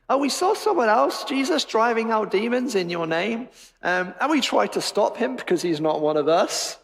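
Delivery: lightly acted tattletale, pitched high at the start; high, eager report of someone to tell on; mock tattling, starting high and easing down; tattling disciples.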